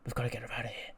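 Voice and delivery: hushed. Retold or imitated. Manny to Dio outside the room, a hushed urgent plea. Secret plan after psst.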